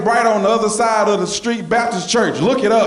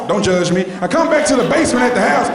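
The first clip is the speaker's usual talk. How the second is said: ordinary delivery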